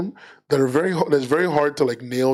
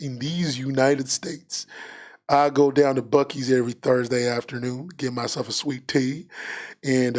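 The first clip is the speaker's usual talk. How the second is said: put-on Texan twang, drawn out in places; Texan accent. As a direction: play a proud Texan welcoming visitors. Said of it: "My Texan accent".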